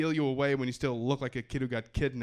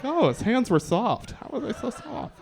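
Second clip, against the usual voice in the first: swooning woman; 7 semitones higher, 6 dB louder than usual. Retold or imitated. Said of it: "They're like".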